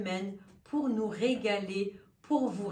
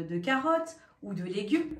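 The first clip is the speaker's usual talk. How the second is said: ordinary talk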